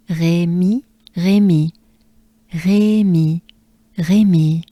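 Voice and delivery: "Rémy" with back-of-throat French R; French Rémy, spaced out for repetition; French R demo. Shown as a demonstration. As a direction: model Rémy evenly, take after take. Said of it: "French R".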